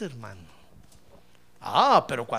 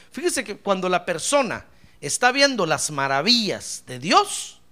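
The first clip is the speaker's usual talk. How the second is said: ordinary delivery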